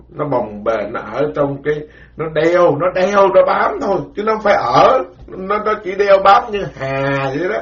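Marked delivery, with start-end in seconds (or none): none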